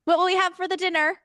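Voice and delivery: put-on high, chirpy Cork Irish accent. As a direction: greet your partner at the door in a Cork Irish lilt. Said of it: "I can hear myself being like".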